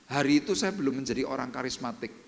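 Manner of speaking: ordinary delivery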